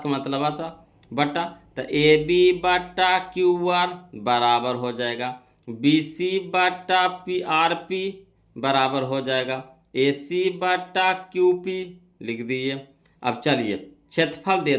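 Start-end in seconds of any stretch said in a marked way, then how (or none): 1.8-12.9 slow, measured reading-out of each ratio; deliberate pace for note-takers; slow dictation. Real, not acted. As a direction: dictate letter by letter, unhurried. Dictating ratios for students to write.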